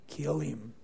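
soft and cold, the order dropped low; quiet deadly order. Acted. Absalom quietly ordering his servants to strike, cold hush. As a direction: murmur the kill order like a secret.

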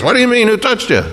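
high, quick, baffled question; incredulous disciples. Acted. Disciples questioning Jesus in the jostling crowd, incredulous. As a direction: throw it back in high-pitched disbelief. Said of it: "They said to Jesus".

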